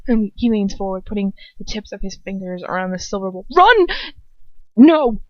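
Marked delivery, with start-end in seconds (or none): 3.6-5.2 high, loud cries of alarm; desperate full-voiced screaming warning; panicked shout. A narrator's reading. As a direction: yell in panic to stop him. Character cries out to stop him.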